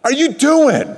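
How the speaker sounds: put-on sharp, high angry shout at the kids; dad yelling at the kids in the car. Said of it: "I'm angry".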